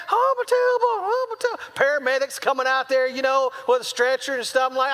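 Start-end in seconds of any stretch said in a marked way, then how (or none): 0.1-1.7 hammed-up child's howl; mock screaming. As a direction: imitate a kid screaming in pain. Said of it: "He's screaming".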